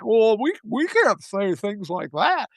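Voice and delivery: slow, hesitant mocking whine; slow mock-cautious politician voice; mock timid Democrat. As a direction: mimic a spineless Democrat hedging. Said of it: "Democrats are like".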